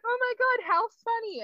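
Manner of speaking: high, mock-amused crowd voice. Everyone at the prison, mock amused and high. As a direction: squeal it like a fake-delighted crowd. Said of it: "everyone's like".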